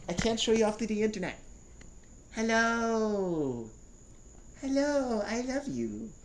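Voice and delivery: high cooing for the pet. Real, not acted. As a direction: coo lovingly at the dog. Cooing at the dog.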